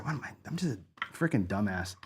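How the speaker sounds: ordinary delivery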